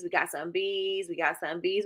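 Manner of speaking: ordinary delivery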